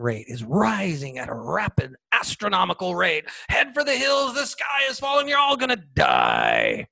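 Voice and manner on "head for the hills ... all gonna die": mock panic. Mocking media panic.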